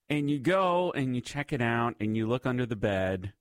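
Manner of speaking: normal talking voice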